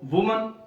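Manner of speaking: वुमन rising, two syllables WU-man; correct pronunciation. Shown as a demonstration. Teacher repeating the right word, वुमन. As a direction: lift वुमन as the answer. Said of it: "दो syllables हैं इसके".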